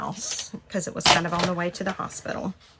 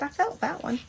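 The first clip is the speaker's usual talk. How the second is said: startled by pain; 8 semitones higher than usual. Retold or imitated. Feels a contraction.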